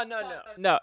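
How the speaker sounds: usual speaking voice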